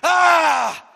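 a high, loud cry of frustration; exasperated shout. Retold or imitated. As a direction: yell it out at the breaking point. Peter melting down.

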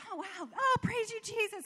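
high, hushed, awed thanks; amazed praise. Retold or imitated. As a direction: marvel quietly at the miracle. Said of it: "I was like".

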